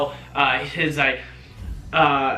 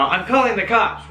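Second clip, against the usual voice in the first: higher, a bit louder replay of the yell; mock dramatic yell; 6 semitones higher, 3 dB louder than usual. Shown as a demonstration. Replaying her own yell for viewers, mock dramatic. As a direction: recreate the yell, half-hearted. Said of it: "like that".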